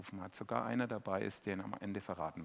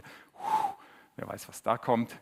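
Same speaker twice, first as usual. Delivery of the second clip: mock wary sneer; 6 dB louder, slower than usual. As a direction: poke fun at snobbish prejudice. Leute spotten über einen Stadtteil. (spöttisch, Leute lästern über einen Stadtteil)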